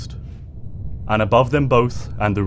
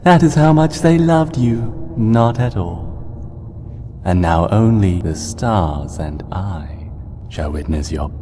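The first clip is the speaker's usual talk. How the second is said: menacing monster voice; 3 semitones lower, slower than usual. Acted. Nightmare Moon gloating over Celestia's end, cold and menacing. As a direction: gloat over a doomed sister.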